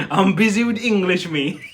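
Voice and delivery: loud laughing aside. Real, not acted. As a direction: laugh while protesting.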